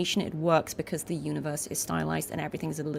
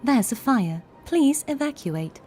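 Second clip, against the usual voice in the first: higher, bright cheerful announcer, slow at the end; cheery sci-fi announcement; 7 semitones higher, 4 dB louder, slower than usual. Shown as a demonstration. Demoing a fire alert in a cheerful sci-fi voice, higher and bright. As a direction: chirp the fire warning like a friendly robot. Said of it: "great for sci-fi horror".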